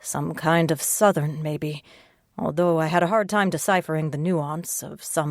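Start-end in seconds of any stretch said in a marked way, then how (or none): none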